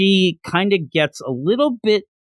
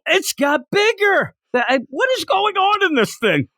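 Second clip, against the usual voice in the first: high-pitched, hammed-up disbelief; incredulous squeal, played for laughs; mock astonishment; 13 semitones higher than usual. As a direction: act baffled as the thing grows. Acting out character's astonishment.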